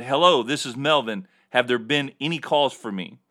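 ordinary talk, nothing marked

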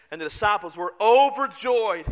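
ordinary talk, nothing marked

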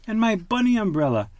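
put-on light up at the old umbrella; mother duck delighted. A narrator's reading. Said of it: "cried Missus Duck".

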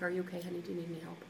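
lightly voiced calm husband; super calm. Husband gently checking on frustrated wife, soft and calm. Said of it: "And just super calm".

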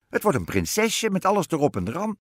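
high, loud witch voice; assuring witch. A narrator's reading. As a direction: promise it emphatically as a storybook witch. Witch promises queen a princess.

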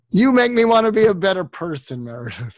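heartfelt joke to his friend Meredith; playful twist on the quote. Acted. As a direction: swap the line to personal with a grin. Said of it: "I'll do my Jack Nicholson impersonation".

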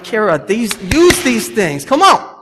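rising to a high, loud plea on come on; urging a clumsy kid to use her hands; exasperated dad calling out; exasperated coaching call. Retold or imitated.

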